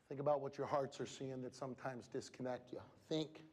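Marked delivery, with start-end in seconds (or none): none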